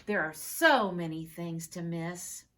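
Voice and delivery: lilting sing-song recital, building to a cheer; poem read aloud. Acted. Class poster read to the teacher, recited with growing cheer.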